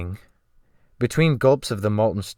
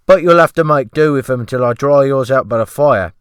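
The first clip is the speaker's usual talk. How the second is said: loud, high servile cook voice; fawning sea cook, pitched up and loud; ship's cook; 4 semitones higher, 10 dB louder than usual. Acted. The cook asking the rescued man, in a loud high fawning voice.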